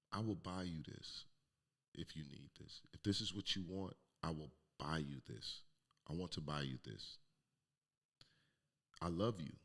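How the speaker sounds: soft, measured godmother's reassurance; gentle. Retold or imitated. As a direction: quietly offer forgiveness, with pauses. Godmother's gentle forgiving reply, acted.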